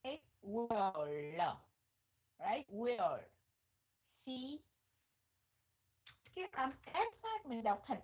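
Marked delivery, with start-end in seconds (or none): 0.4-1.6 "will" with the final L made by curling the tongue; curled-tongue L. Shown as a demonstration. Teacher demonstrating the L sound, curled-tongue "will". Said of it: "thì mình phải cong lưỡi". 2.7-3.2 "will" with the final L made by curling the tongue; curled-tongue L. Shown as a demonstration. Teacher demonstrating the L sound, curled-tongue "will". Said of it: "thì mình phải cong lưỡi".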